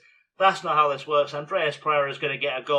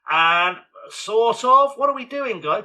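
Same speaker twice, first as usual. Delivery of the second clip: loud, higher cry of disbelief at the botched chance; exasperated shout; 7 semitones higher, 8 dB louder than usual. Real, not acted. Commentator yelling at his players after a botched chance, exasperated shout.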